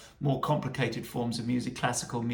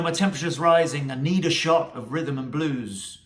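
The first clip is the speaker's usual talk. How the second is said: reciting lyrics; 6 dB louder than usual. Acted. Reads lyric aloud.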